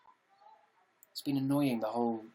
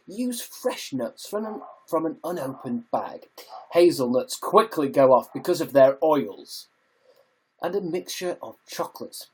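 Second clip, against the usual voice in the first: measured voiceover narration with lifted emphasis; audiobook voice; 4 dB louder than usual. Shown as a demonstration. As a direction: narrate it like an audiobook, drawing out the phrases. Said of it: "I need to practice my audiobook voice".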